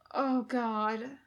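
muffled through palms; face in hands. A narrator's reading. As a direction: groan into your hands. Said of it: "he says into his palms".

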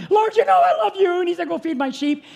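high, loud revival preaching; preaching at full force. Acted. Preacher to congregation about Jesus praying, preaching at full force. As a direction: preach it out to the church, full throat.

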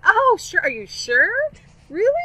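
high, loud, overeager mom; 엄마가 들떠서 높게 되묻기; mock eager. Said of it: "And I'm like".

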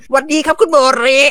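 high, loud cheery greeting in a put-on voice; goofy cop greeting. A fan mimicking the Gunma cop greeting Mori, high and loud. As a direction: chirp it up high like an eager rookie.

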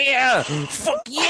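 high, squealed imitation of a gloating winner; over-the-top triumphant yell; mock victory scream. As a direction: imitate his screaming celebration. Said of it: "he's screaming so loud".

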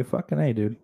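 usual speaking voice

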